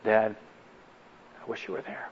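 barely voiced, choking back tears; tearful whisper. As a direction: let the son's words slip out in a broken whisper. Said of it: "big tears running down his eyes".

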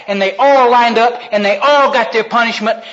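ordinary delivery

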